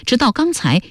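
ordinary delivery, nothing marked

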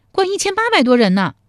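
put-on high, quick playful young woman; girl's teasing voice. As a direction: tease him in a bright girlish voice. 陶三春 boasting. (少女俏皮地调侃)

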